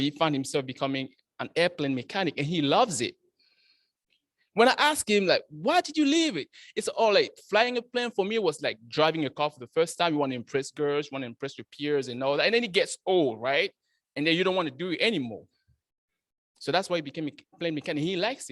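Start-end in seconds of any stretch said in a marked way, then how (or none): none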